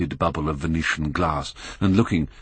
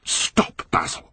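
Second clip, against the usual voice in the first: raised voice, breaking off sharply; a rising protest, louder on the last words; cried out; 5 semitones higher, 4 dB louder than usual. A narrator's reading. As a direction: cry out to cut Basil off. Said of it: "cried Dorian".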